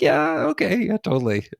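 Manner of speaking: breezy mock acceptance; easy agreement. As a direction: pitch jumps high on the first yeah.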